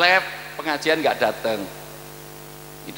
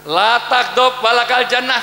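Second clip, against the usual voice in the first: slow, loud, higher Arabic recitation; recited hadith; 8 semitones higher, 6 dB louder, slower than usual. Acted. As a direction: intone the Prophet's words slowly. Quoting the Prophet in Arabic.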